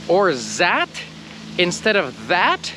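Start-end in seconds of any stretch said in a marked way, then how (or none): 0.3-0.9 "zat" with buzzing z; mock z for th. Shown as a demonstration. Teacher scolding learners, mock wrong. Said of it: "if you're saying".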